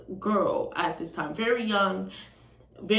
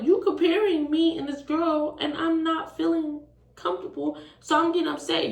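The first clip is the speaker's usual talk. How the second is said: higher-pitched indignant retelling; upset protest; 8 semitones higher than usual. Retold or imitated. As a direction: argue back, voice raised in pitch. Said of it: "Yeah, I'm getting upset".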